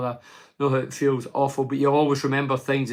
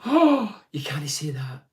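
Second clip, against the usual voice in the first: mock offended bystander; slower than usual. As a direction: play the easily offended busybody, lightly mocked. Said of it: "and everybody goes".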